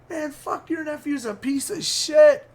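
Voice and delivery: high-pitched belligerent imitation; imitated high-voiced taunt; mock angry insult. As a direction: spit it out high, imitating a sore loser.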